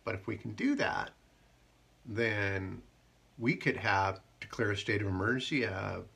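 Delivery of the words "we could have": normal talking voice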